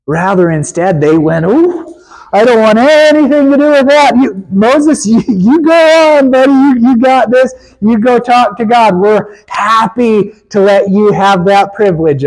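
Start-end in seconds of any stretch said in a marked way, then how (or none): 1.5-12.2 scared Israelites passing the buck. Acted. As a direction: pass the job off with cowardly cheer. Israelites push Moses to God.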